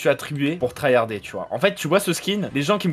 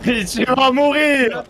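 laughing shout; 8 semitones higher, 6 dB louder, much slower than usual. Real, not acted. Laughing during close fight.

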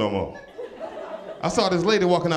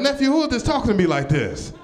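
higher and louder, indignant aunt; mildly affronted auntie demanding who this is; lightly voiced offended auntie; 7 semitones higher, 3 dB louder than usual. Auntie confronting the fake account, mildly indignant and higher. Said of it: "She be like".

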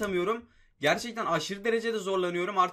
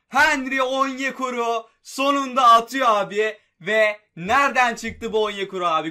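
higher, much louder, slow, triumphant yelling; goal celebration; 5 semitones higher, 10 dB louder than usual. Real, not acted. Gamer celebrating his striker's equaliser, yelling. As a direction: roar it out like a goal call.

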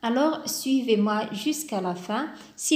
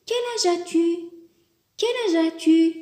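higher, questioning voice; asking a friend; 6 semitones higher than usual. Shown as a demonstration. Teacher acts out asking a friend their age. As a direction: ask it as if to a friend. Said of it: "on lui dit".

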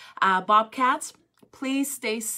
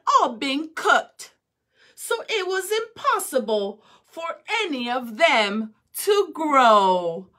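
much louder, higher, slow royal proclamation; proclaiming emperor; 4 semitones higher, 7 dB louder, slower than usual. Acted. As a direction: announce it to the whole court. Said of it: "he exclaimed to one and all".

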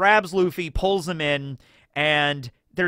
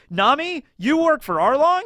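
much higher, louder disbelieving question; 11 semitones higher, 5 dB louder than usual. Retold or imitated. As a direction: ask it betrayed and stunned. Luffy shocked at betrayal.

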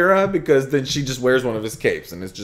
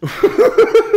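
quick high burst of laughter. Real, not acted. Laughing at cohost's joke. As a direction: laugh at the punchline.

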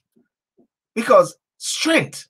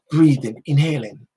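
ordinary talk, nothing marked

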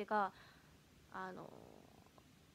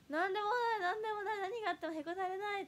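idol catchphrase; 8 semitones higher, faster than usual. Pretend. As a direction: belt it out like a young idol. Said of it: "やばいか".

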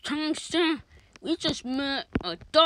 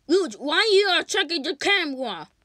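normal talking voice

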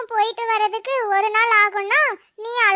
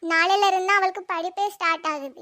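ordinary delivery

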